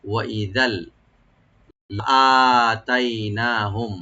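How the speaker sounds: লাআতাইনাহুম with no stretch on লা, long on the hamza alif; tajweed demo. Shown as a demonstration. Teacher showing students where the elongation falls. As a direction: stretch লাআতাইনাহুম only after the hamza, very slow. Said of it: "প্রথম লাতে কোনো টান নাই".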